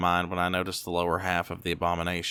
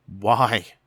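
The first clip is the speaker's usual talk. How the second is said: louder, breaking cry; voice cracking; 2 semitones higher, 7 dB louder than usual. A narrator's reading. Son asks his mother why, voice cracking. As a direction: let the voice crack on it. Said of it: "My voice cracks".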